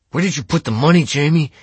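high, loud angry demand; snarled. A narrator's reading. Kittredge demands the money from Jamie, snarling. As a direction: snarl the accusation, pushing pitch and volume up. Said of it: "Kittredge snarled".

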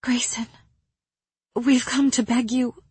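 a hint of hushed pleading, pitched high; whispered plea. A narrator's reading. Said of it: "Elaine whispered".

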